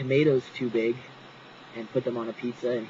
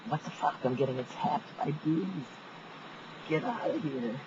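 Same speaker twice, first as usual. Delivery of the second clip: high, hushed startled muttering; real alarm at bees; 3 semitones higher, 8 dB quieter than usual. Real, not acted. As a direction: fret under your breath as bees close in. Bees interrupt the story.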